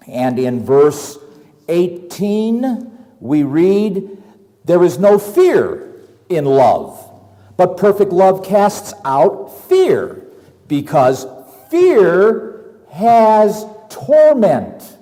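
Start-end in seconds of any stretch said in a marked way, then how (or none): 4.6-14.9 slow, weighty reading of scripture. Acted. Preacher reading First John to his brethren, slow and weighty. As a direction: lean on each phrase, slowly.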